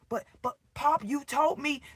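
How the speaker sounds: high mock whine of a scolded kid; mock pleading child.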